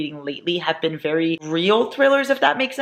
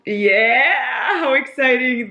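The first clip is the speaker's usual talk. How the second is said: mock-excited, higher and louder; sarcastic; 7 semitones higher, 4 dB louder, much slower than usual. Real, not acted. Teasing partner about Legos in exaggerated mock excitement. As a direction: tease him with fake enthusiasm. Teasing Giant's Lego build.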